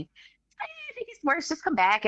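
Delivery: mock the careless doctor; imitating the doctor again. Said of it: "that's how he was talking".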